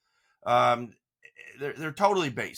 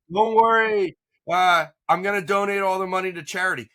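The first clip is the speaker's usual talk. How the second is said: loud, higher-pitched amateur impression; mock a boastful promise, raised and loud; Donald Trump; 4 semitones higher, 7 dB louder than usual. Lawyer mimicking the President's pledge, amateur political impression. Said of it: "I don't do Trump as well as you guys".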